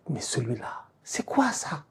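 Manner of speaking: half-whispered disbelief; very soft, awestruck near-whisper; stunned hush. Real, not acted. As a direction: drop to an incredulous whisper. Stunned recall of a save.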